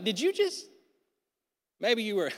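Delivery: high-pitched, incredulous half-question; stunned disbelief. Acted. Preacher imagines doubting the angel's greeting, stunned disbelief. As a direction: blurt it high, unable to believe it.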